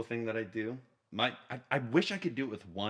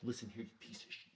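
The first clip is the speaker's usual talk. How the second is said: hushed menace; 12 dB quieter, faster than usual. Shown as a demonstration. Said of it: "it's just like".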